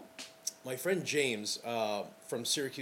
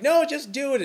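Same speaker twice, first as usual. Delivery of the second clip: loud, much higher push to just do it; insistent shout; 12 semitones higher, 9 dB louder than usual. Retold or imitated. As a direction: call it out firmly and high. Insisting, calling to the bride.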